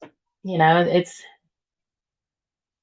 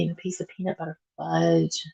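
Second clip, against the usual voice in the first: ordinary talk, nothing marked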